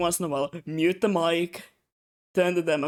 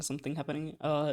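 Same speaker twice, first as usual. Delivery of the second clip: ordinary delivery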